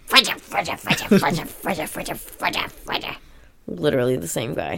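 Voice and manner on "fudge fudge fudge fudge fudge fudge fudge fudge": muttered fake cursing, fading out; Joe Pesci. Host imitates Home Alone's fake swearing, muttering repetitively. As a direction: grumble the same word over and over, trailing into a whisper. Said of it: "Joe Pesci in Home Alone".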